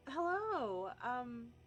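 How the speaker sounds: a hint of someone trying to seem normal; lightly acted greeting. Player's character greeting strangers on the stairs, lightly acted high hello. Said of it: "turn around and go".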